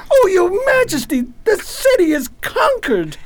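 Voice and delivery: high, alarmed report. Acted. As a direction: burst in with bad news. Soldier reports the conquest.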